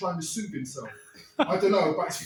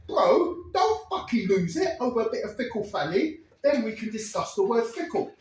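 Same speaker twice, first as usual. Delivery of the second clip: bolder, higher, louder take; louder retake; 5 semitones higher, 5 dB louder than usual. Retold or imitated. Teller retakes the line to the crowd, louder and higher. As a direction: say it again with more punch. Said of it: "What I really wanna say is".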